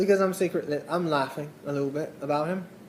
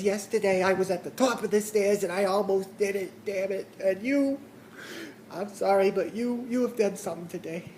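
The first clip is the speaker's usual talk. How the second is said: put-on higher, soft, pitiful older voice; pathetic complainer; 4 semitones higher, 4 dB quieter than usual. Despairing caller thanking the lady who helped, pathetic voice. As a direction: play a feeble, overwrought caller giving thanks. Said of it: "forgot who I, who I was for a second".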